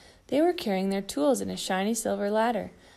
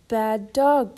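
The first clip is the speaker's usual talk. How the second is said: scolding; 2 semitones higher, 7 dB louder than usual. Acted. Scolding the dog.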